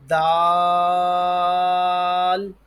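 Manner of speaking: دال with tongue tip at upper tooth roots, vowel held long; tongue-tip daal. Shown as a demonstration. Teacher models the letter for pupils, long, loud دال. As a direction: sustain دال loudly on one note. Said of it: "جس طرح تا ادا ہوا ویسے ہی دال".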